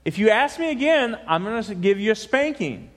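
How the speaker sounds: acted parental telling-off; threatening mother. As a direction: warn the child like a fed-up mom. Mother threatens son in joke.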